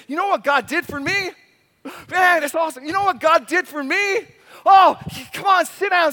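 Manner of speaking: bursting to share good news; excited testimony. Retold or imitated. Believer telling friends what God did, excited. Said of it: "I'm gonna say".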